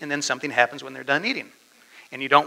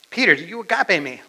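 raised, louder pressing question; insistent question; 6 semitones higher, 6 dB louder than usual. Acted. Jesus presses Peter again about his love, insistent. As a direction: press him firmly for an answer.

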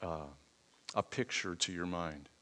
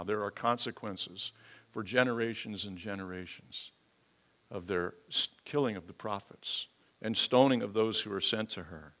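ordinary delivery

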